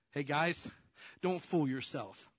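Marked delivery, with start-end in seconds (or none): none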